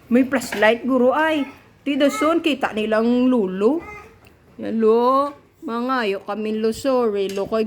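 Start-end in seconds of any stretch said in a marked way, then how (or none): none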